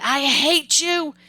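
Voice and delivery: put-on high, slow, drawn-out angry teen cry; mother said no to a teenager, so the teen lashes out; teenage outburst. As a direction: fling it at your mother like a furious teenager. Teen shouting at mother, slams door.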